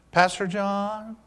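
soft, slow impression of a young camper; ten-year-old camper. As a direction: imitate an earnest boy drawing out each word. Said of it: "now this is how he talked".

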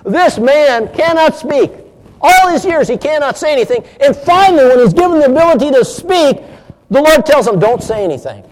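high, loud incredulous quote; preacher quoting another preacher in disbelief; quoting John MacArthur. Retold or imitated. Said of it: "I heard John MacArthur on this".

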